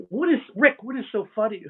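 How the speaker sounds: loud, high mock outburst; imitated exasperated demand. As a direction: demand an answer from the giggler, loudly. Friends demanding why he's laughing.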